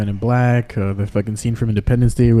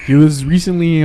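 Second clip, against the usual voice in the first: excited; 5 semitones higher, 4 dB louder than usual. Real, not acted. Excited about the topic.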